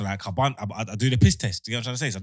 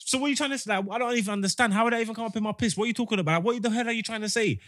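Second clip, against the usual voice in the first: much higher pitched baffled complaint; exasperated protest; 12 semitones higher than usual. Retold or imitated. To the cops, a high exasperated protest. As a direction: plead you can't follow them.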